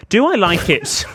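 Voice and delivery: high, loud mock role-play question. Host offers co-host a role play of the letter, hammed-up high voice. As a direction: pitch it up and overplay the question. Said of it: "should we do a role play?".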